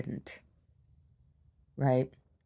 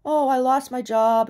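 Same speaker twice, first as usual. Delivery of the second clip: mock whiny customer; 8 semitones higher, 5 dB louder than usual. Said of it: "wants to tell me about".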